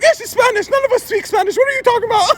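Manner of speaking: high-pitched incredulous ranting; exasperated grandpa. Retold or imitated. Grandpa snapping back at teller, exasperated high voice. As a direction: argue back in mock outrage.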